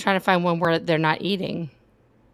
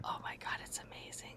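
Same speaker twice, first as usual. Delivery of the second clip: whispering; 21 dB quieter than usual. Real, not acted. Awed aside over the spider.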